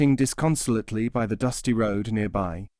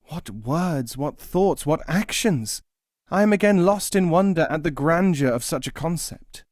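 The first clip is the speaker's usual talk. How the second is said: building higher in rapture; awed pious man; 6 semitones higher than usual. Acted. Pious man marvels at the Sufi, rising higher. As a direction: let the wonder swell.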